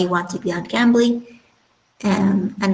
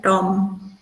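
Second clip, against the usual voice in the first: "Tom" in an Indian accent, tongue further back. Shown as a demonstration. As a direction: say "Tom" Indian-style with a retracted T. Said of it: "for Indian accent, I think you put it further back".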